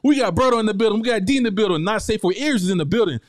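lively host welcome; hype host roll call. Acted. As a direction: hype up the crowd like an MC calling names. Hosts roll call of guests.

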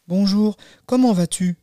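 put-on high childlike hello; bright-pitched storybook child voice; little girl greeting. Girl greets grandmother at bedside.

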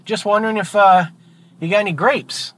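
high-pitched, easygoing duck; casual duck. Acted. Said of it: "The duck says".

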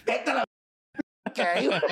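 mock outrage. Comedian acts his outrage at the 4 a.m. "ok", high and indignant. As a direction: squeal it in disbelief. Reacting to late text.

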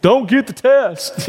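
high, whiny fake sob to his wife; mock crying. Husband texting wife after painful swab, hammed-up crying. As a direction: play up the pain, half-laughing whimper. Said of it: "like, I was crying".

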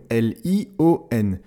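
slow, letter by letter; spelled out. Shown as a demonstration. Teacher spelling the word for learners, slowly. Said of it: "le mot lion".

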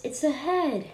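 mild surprised cry; cried out in surprise. A narrator's reading. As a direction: gasp it out in shock. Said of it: "cried out in surprise".

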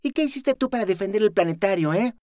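high, cutting rebuke; sarcastic challenge. Acted. Luzma challenging the girl, sarcastic. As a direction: needle her with contempt.

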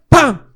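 high, very loud vocal "pam"; imitated bang. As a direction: punch out one big band hit. Imitates the band suddenly kicking in. (golpe imitado, agudo y fuerte)

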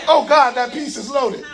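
ordinary talk, nothing marked

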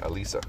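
"Alyssa" said uh-LEE-suh, long ee in the middle; correct name pronunciation. Shown as a demonstration. Correcting himself on his friend's name, "Alyssa" as uh-LEE-suh. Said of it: "that's how she pronounces it".

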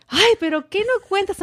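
high-pitched exasperated mother; amused, high mock scolding; imitating scolding mom. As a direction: nag in a put-on mom voice. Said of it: "Why are you screaming at me?".

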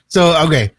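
suppressed laughter breaking out; trying not to laugh. Real, not acted. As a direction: try to carry on through a laugh. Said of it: "I'm trying not to laugh".

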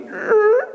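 high squeaky crack sound; mock chiropractic crack; cracking noise. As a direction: make the spine's pop with a high squeak. Mimics spine cracking straight.

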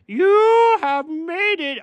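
slow, grand announcing voice; booming voice. Acted. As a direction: boom it out slowly. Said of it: "Oh, that voice".

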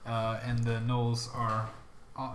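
ordinary talk

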